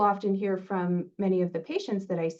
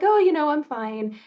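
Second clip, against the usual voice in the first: higher, louder imitated "I'm fine" reply; casual brush-off; 8 semitones higher, 6 dB louder than usual. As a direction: brush the question off in a raised, retold voice. Retold brush-off to a sincere question.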